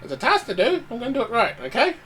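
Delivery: high, gruff little halfling. Acted. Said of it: "in my most gruffest halfling voice".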